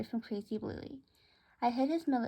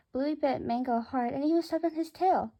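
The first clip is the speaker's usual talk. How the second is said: ordinary delivery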